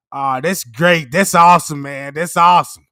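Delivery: hammed-up phone approval; fake call praise. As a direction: gush over the numbers for show. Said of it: "little fake call".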